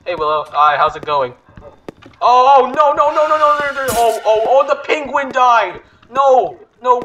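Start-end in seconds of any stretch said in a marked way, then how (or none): 2.2-7.0 high, loud rising panic; real alarm as the teammate dies; panicked no's. Real, not acted. Gamer watching the penguin die, high panicked cries.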